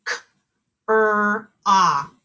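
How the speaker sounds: "T, er, ooth" said as three separate sounds with pauses; teacher segmenting "T, er, ooth" sound by sound. Shown as a demonstration. Teacher models sounding out a word for kindergartners, segmented. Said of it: "Make sure you're saying them".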